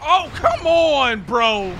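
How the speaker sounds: loud, high, drawn-out complaint; exasperated whine. Real, not acted. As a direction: stretch it out, fed up. Exasperated at game.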